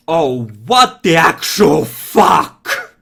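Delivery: very loud, drawn-out yell; furious scream. A narrator's reading. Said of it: "scream out".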